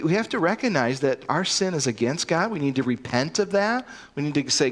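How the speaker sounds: ordinary talk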